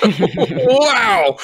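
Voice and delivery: high, loud wow with a laugh; amazed wow. Real, not acted. Awed reaction to hopeful passage.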